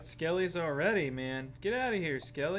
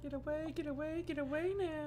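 squeaky mock voice; 8 dB quieter than usual. Mocks friend's get away.

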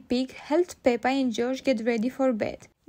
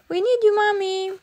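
put-on high, louder child's plea; crying out; 8 semitones higher, 5 dB louder than usual. A narrator's reading. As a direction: call for help as a little girl. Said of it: "cried Peppa".

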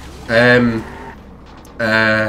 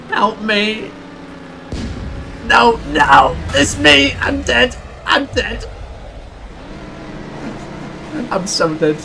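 terrified gibbering; 10 semitones higher than usual. Real, not acted. Panics at elimination countdown.

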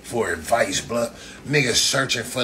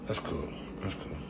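muttered sarcasm; 5 semitones lower, 14 dB quieter than usual. Real, not acted.

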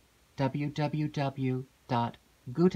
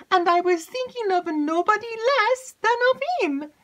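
high, loud eager maid's voice; breathless excited maid; 7 dB louder than usual. Acted. Maid rushes in to tell Emily news, excited.

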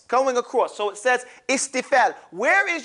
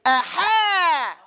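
shouted aha; 7 semitones higher than usual. Shown as a demonstration. Teacher leads the class in a shouted aha. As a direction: call the aha out big.

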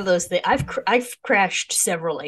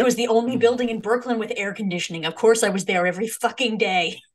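higher, emphatic mock grandpa; lightly voiced, exasperated old moviegoer; retold grandpa; 5 semitones higher, 4 dB louder, faster than usual. Grandson imitating grandpa defending his picture show habit, emphatic retold grandpa. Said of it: "He was like".

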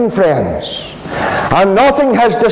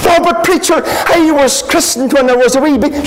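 higher, fast, pleading voice of a churchgoer; protesting churchgoer; 7 semitones higher, faster than usual. Retold or imitated. Churchgoer insisting to the preacher, high and rushed. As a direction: pitch up and rushed, defending his christening.

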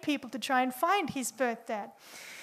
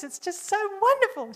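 quoting her friend, pitch lifted in giddy delight; 6 semitones higher than usual. Retold or imitated. Retelling a reunited sweetheart, giddy and high. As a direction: let it float up like a smitten teenager. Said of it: "She was all giddy about it".

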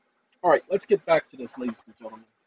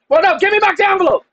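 higher, louder and fast, put-on clerk; mock demanding clerk; 11 semitones higher, 5 dB louder, faster than usual. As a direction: insist like the pushy clerk.

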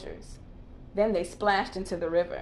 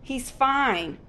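snapping; 5 semitones higher than usual. Acted. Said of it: "snapped Timon".

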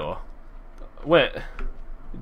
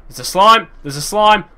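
excited; 8 semitones higher, 9 dB louder than usual. Real, not acted. Spotting a slime.

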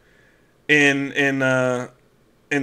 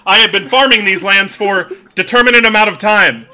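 a stiff, formal robot farmer with laughs breaking through; robotic farmer; 5 semitones higher, 4 dB louder than usual. Acted. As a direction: farmer announcing himself like a machine, barely holding the laugh. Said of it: "I love Warforged".

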